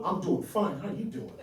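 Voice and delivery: ordinary talk, nothing marked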